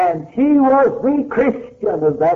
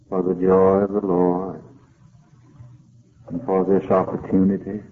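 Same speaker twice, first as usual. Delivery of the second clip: closing prayer; 5 semitones lower than usual. Real, not acted. Closing prayer led by preacher.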